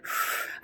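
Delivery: audible unvoiced exhale; long releasing sigh; deep breath out. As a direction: blow the breath out to settle down. Acts out breathing on getting home.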